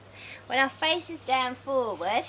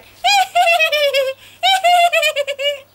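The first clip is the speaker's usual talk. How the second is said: very high, loud squeaky hee hee; high squeaky duckling tittering; ducklings' giggle; 11 dB louder than usual. Retold or imitated. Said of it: "the babies are going".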